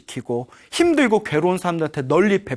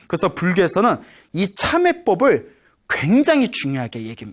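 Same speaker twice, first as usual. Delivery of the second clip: normal talking voice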